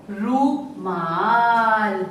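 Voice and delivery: रूमाल with the ऊ drawn out long and slow. Shown as a demonstration. Teacher to class, stretching रूमाल slowly. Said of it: "जल्दी से बोला जा रहा है".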